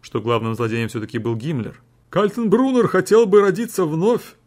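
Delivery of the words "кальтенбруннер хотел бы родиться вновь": loud, high, grandiose courtroom exclamation; exclaiming with pathos. A narrator's reading. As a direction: proclaim it with booming pathos. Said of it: "с пафосом воскликнул защитник".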